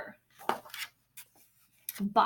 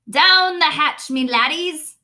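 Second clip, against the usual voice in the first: loud, slow table-pounding toast; hearty pirate toast; captain yelling; 9 dB louder, slower than usual. Acted. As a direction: bellow it as a rowdy toast. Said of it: "Braid Beard pounded his fist on the table and yelled".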